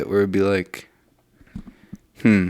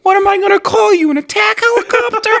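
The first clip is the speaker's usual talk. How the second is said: very high, wailing protest; hammed-up childish indignant whine; whiny little boy. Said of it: "doing a whiny little".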